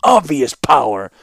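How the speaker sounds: loud, scornful boast; contemptuous sneer. A narrator's reading. Young ruler flaunting his power to the warlord, sneering. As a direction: sneer at his hopeless position. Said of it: "with a contemptuous sneer".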